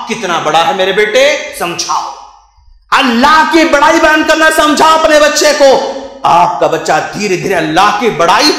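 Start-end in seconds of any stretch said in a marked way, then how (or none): 2.9-5.7 impassioned preaching. Acted. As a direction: preach it forcefully to the congregation. Preaches at full force. (जोशीला उपदेश)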